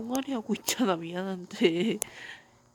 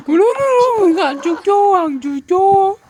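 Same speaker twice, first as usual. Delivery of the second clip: scared horror game streamer; 9 semitones higher, 11 dB louder than usual. Real, not acted.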